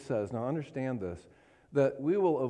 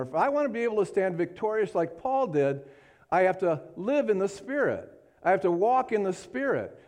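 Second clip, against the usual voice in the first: higher-pitched, driving preacher's delivery; fervent preaching; 8 semitones higher, 4 dB louder than usual. Real, not acted. Preacher testifying to congregation about walking in the Spirit, fervent. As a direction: preach it with rising conviction.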